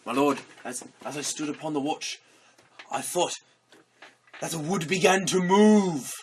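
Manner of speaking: lightly acted guard's report. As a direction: report to your lord, building on the last words. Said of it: "one of his guards comes in and says".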